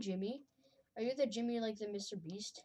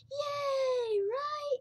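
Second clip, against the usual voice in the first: mock cheer; 13 semitones higher, 4 dB louder than usual. Real, not acted.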